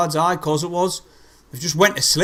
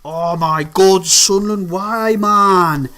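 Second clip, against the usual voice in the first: despairing groan; 3 semitones higher, 5 dB louder, much slower than usual. Real, not acted. Fan despairing after goal.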